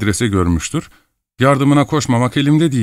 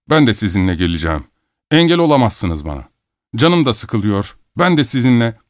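usual speaking voice

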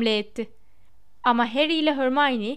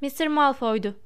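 ordinary delivery

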